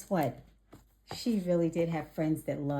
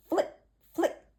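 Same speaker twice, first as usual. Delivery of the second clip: frog voice; 11 semitones higher than usual. Acted. Frog voice in story.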